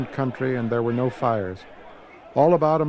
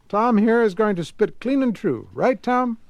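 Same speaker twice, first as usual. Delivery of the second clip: a man's voice, lifted higher, jovial; Grigsby; 7 semitones higher than usual. A narrator's reading. Grigsby jokes to Tom in the queue, lightly higher, jovial. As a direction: cheer the lad on.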